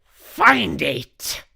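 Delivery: high, very loud witch scream; screamed command. A narrator's reading. Grand High Witch orders the hunt, screamed command. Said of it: "screamed the Grand High Witch".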